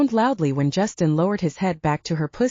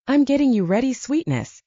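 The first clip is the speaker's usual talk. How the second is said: ordinary delivery, nothing marked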